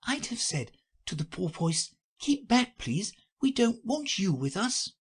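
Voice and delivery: put-on high girl voice; a girl imagining herself a whiting; Alice's voice. A narrator's reading. Alice tells the Mock Turtle what she'd say, girlish voice.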